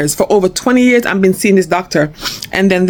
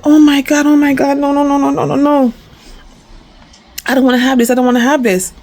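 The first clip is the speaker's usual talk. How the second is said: panicked denial; 6 semitones higher than usual. Retold or imitated. Reacting to diabetes news.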